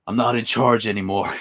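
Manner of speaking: lightly voiced American TV hero; Rick Grimes impression. Streamer imitates actor's slipping accent, lightly voiced American hero. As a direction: put on a hint of an American accent. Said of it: "plays Rick Grimes".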